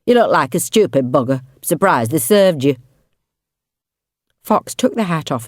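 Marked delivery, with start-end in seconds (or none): 0.0-2.8 put-on louder, blunt Yorkshire accent; scornful man with a Yorkshire accent. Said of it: "in a Yorkshire accent".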